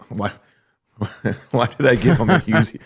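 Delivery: put-on failed Russian accent breaking into laughter. Comic botching a Yakov quote in Russian accent. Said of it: "Can't do a Russian accent".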